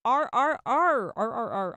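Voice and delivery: slow growl on "R R R". Shown as a demonstration. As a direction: roll out "R R R" like a growl. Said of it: "I'm not talking like a dog".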